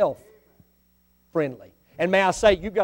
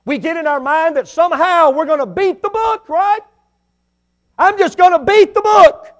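high, loud mocking of the boast; 10 semitones higher, 9 dB louder than usual. Real, not acted. Preacher mocking people who think they'll beat the book, high and loud. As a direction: lampoon the boastful sinner.

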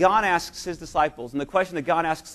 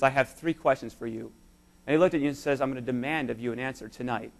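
softer and lower, solemn; hushed voice of God; 5 semitones lower, 7 dB quieter than usual. Retold or imitated. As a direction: speak God's words quietly. God speaking to listener.

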